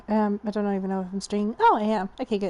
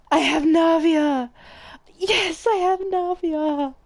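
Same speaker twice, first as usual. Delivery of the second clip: higher, drawn-out celebration; elated; 6 semitones higher, slower than usual. Real, not acted. Streamer celebrating getting Navia, elated. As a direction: savour it, voice lifted with joy.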